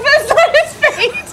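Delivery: high, loud giggly quote; cracking up mid-line; laughing through words. Real, not acted. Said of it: "I'm like".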